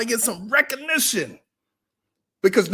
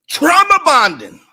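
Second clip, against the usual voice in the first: loud; 6 semitones higher, 9 dB louder than usual. Real, not acted. Loud punchline.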